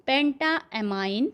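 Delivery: each syllable stretched out very slowly; slow dictation. Shown as a demonstration. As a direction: spell out penta amine slowly for the class. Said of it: "तो किस तरह से हमने इसे बोला बच्चों". (बहुत धीरे, खींचकर बोलना)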